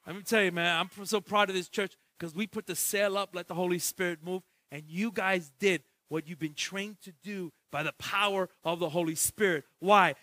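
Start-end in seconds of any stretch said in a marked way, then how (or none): none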